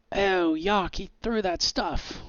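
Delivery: high-pitched mock yell; cops' yell. Game master voicing cops reacting to thrown goop, mock yell. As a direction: call out high, like an alarmed cop. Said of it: "you hear the cops yell".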